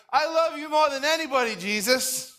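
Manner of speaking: high, gushing devotion; overzealous boasting. Retold or imitated. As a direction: gush like an overeager new convert. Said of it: "like, overzealous".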